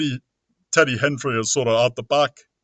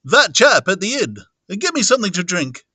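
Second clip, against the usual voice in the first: raised, louder agitated character voice; urgent and rattled, pitched up; Mister Cuss; 6 semitones higher, 4 dB louder than usual. Acted. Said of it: "Now, Mister Cuss".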